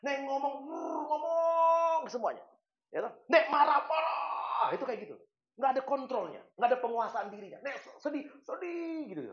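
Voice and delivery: a lightly hammed-up unhinged rant; unhinged person. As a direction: act out a person with no self-control. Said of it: "Dol itu".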